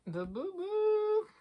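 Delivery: drawn-out, high "bou bou bou"; rising singsong placeholder while you look; searching filler noise. Real, not acted. Vlogger stalls while finding the influencer's name, high drawn-out bou-bou.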